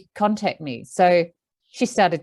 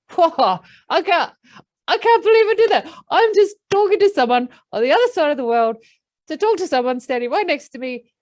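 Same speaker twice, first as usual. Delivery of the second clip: much higher, fast, amazed and giddy; elated horse voice; 9 semitones higher, 4 dB louder than usual. Retold or imitated. Horse marvels at talking across the world, high and elated. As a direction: play the horse, thrilled at what it just did. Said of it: "I could hear Tessa the horse going".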